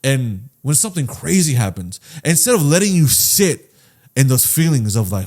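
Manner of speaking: usual speaking voice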